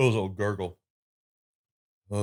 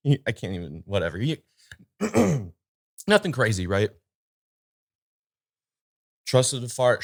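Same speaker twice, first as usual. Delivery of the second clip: normal talking voice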